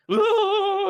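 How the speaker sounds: high, loud imitated enemy scream. Enemies rushing out of darkness, loud high shriek. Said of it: "come running out of darkness like".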